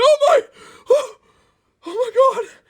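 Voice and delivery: very high, loud, hammed-up panic; mock panic. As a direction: squeal like a toy train about to fall. Booster hanging off the bridge.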